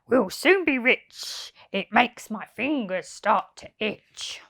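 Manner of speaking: lightly voiced gleeful crook; greedy thief. Len answering Hugh, a lightly voiced greedy glee. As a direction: relish the thought of money.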